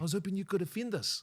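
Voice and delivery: a mock Kiwi bloke; a high, light New Zealand accent; Kiwi guy. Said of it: "I had a Kiwi guy come up and say".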